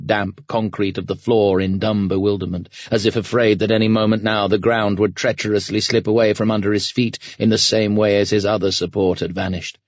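ordinary delivery

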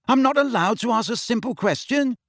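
high, offended protest; indignant. A narrator's reading. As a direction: protest with wounded innocence. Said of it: "said Ron indignantly".

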